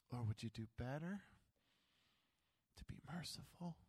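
soft, fading tender question; God quietly inviting his people to mercy; gentle God. Acted. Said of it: "God just might be saying".

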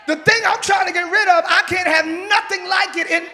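loud, driving preaching; preaching at full force. Acted. Preacher declaring to the congregation what must go, at full force. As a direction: shout it to the congregation.

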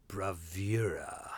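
slow whispered bravura, trying it out; whispered repeat. Shown as a demonstration. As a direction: breathe the word bravura slowly. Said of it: "repeats word to herself".

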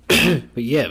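normal talking voice

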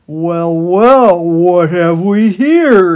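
loud, slow menacing drawl; sly bartender. A narrator's reading. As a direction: savour the catch. Bartender rat greeting.

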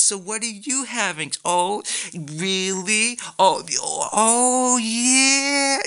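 high, drawn-out mock "oh, really"; fake-fascinated over your order; high, slow, hammed-up fascination; nosy gushing friend. Mimicking nosy dining companions.

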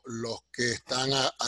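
ordinary talk, nothing marked

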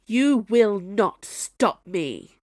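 loud, slow villain howl. Acted. Snow Queen threatening Zee, slow loud howl. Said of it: "the Snow Queen howled at her".

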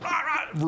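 high, sudden mock barking; short burst of high imitated barks; imitated puppy bark. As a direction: yap like a puppy losing it. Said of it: "the dog just loses it".

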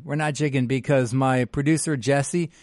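usual speaking voice

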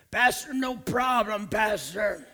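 hammed-up streetwise volunteer, calling out; eager street guy. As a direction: play the tough guy who'll break in for the pastor. Acted eager ex-gangster response.

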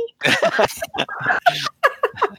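laughter. Real, not acted. Player laughing at how badly it's going, laughter. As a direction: burst of laughter.

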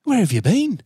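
high, exclaiming imitation of a father; old man. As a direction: burst out as the astonished old man. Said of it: "He went".

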